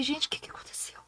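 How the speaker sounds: barely audible whispered bewilderment. Retold or imitated. Teacher praying to herself by the wall, whispering. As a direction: whisper it as a private, anxious thought. Inner words while silently praying.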